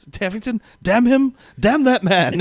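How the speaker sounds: furious yelled curse at the absent officer; yelling. Retold or imitated. Fan quoting Cornwallis yelling after Tavington leaves.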